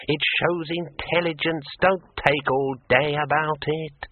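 high, thin, testy ghost voice; Graiture. A narrator's reading. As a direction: hurry them up like an irritable spirit. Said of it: "snapped Graiture's disembodied voice".

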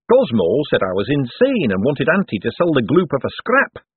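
high, put-out boffin voice; awkward economist, aggrieved. Acted. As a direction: complain like a wounded inventor.

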